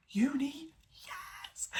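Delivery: high, whispered squeal of delight; hushed excitement. Real, not acted. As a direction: celebrate under your breath. Hushed excitement, someone may overhear.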